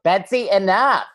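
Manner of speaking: put-on high, loud Boston-accented scolding. Niece imitates her Boston uncle scolding, thick Boston accent. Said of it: "make him say things".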